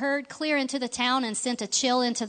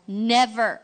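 rumbling anger; 3 semitones higher, 4 dB louder, slower than usual. Acted. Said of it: "his voice rumbled with their power".

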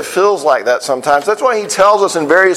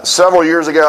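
ordinary talk

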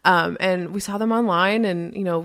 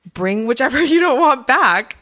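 louder, laughing through the words; laughing quote; 5 semitones higher, 6 dB louder than usual. Retold or imitated. Rescue lady jokingly offering a trial night, laughing. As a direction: laugh at the idea of returning a dog. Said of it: "Sean was like".

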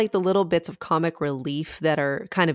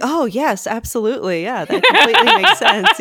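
higher, louder mock eager agreement; 7 semitones higher, 8 dB louder than usual. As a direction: gush along in total agreement, breaking into laughter. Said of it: "I was all for it".

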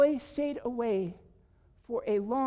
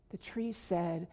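ordinary delivery, nothing marked

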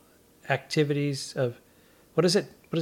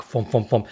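lower, rhythmic thumping sounds; thump noises; 4 semitones lower than usual. Retold or imitated. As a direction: mimic shoes thudding down. Sneakers landing on stage.

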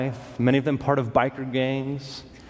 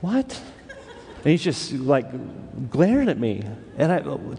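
normal talking voice

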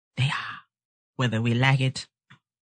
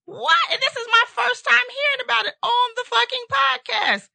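shocked yelling. Real, not acted. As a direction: yell in total disbelief. Shocked by daughter's news.

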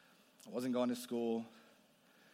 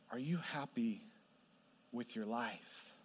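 much softer, slow at the end; stranger's soft question; 4 semitones lower, 10 dB quieter than usual. Retold or imitated. Stranger quietly asking Desi about his life, soft and slow. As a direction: ask it gently, letting it hang. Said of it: "and he said".

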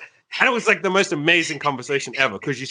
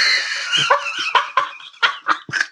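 laughing. Real, not acted. Laughing mid-story.

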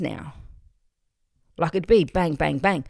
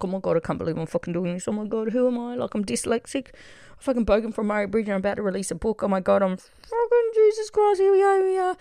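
fast, high panicked babble climbing to a squeal; hyperventilating panic; 5 semitones higher, faster than usual. Retold or imitated. Author about to hit publish on a book, hyperventilating in mock panic. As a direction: gabble it, pitch rocketing as panic builds. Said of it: "like, hyperventilating".

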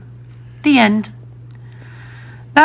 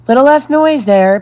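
higher, louder call across the room; 6 semitones higher, 6 dB louder than usual. A narrator's reading. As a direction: Peter calling out to the noisy children, loud. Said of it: "Peter called out".

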